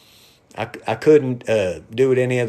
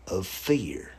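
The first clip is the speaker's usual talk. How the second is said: softer, breathy whispered ending; 5 semitones lower, 8 dB quieter than usual. Real, not acted. Motivational streamer landing his point on fear, whisper. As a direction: whisper the last words for weight. Hushed payoff of motivational line.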